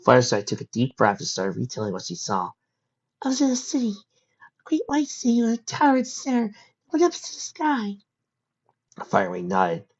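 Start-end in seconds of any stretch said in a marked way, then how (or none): 3.2-8.0 put-on high, light voice of a young pony; young filly voice. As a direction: voice the young filly, a bit shaken. Fireside's voice.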